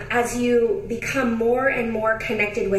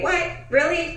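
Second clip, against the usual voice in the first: high, surprised "What? Really?" as the listener; mock disbelief; 8 semitones higher than usual. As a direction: play the listener's stunned double take. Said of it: "You're like".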